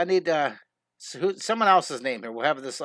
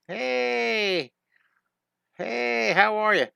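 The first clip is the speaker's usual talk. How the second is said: higher, bright cartoon hello; Bobby waving; 6 semitones higher, much slower than usual. Bobby waves to his friend, higher cartoon greeting. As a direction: call out cheerfully while waving. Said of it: "Bobby's waving".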